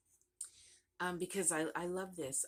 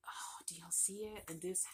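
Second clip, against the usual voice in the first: whisper; 3 semitones higher, 6 dB quieter than usual. Real, not acted. Showing off a detail on camera.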